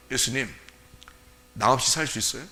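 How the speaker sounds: soft, low, hesitant question; timid. Acted. Believer timidly questioning Jesus, timid and soft. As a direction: ask meekly, not daring to push. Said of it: "그 정도 당당하지도 못해?".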